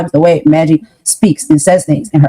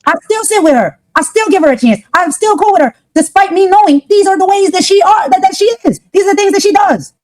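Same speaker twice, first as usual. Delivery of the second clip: higher-pitched, worked-up protest; exasperated insistence; 9 semitones higher than usual. Real, not acted. Defending herself over still sitting with her, exasperated insistence.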